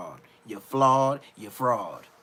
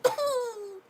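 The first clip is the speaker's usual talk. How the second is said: much higher mock ooh of pain; imitated ooh; 4 dB quieter than usual. Mocking the knocked-out fighter's high ooh. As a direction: coo out a hurt little ooh. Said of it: "he said".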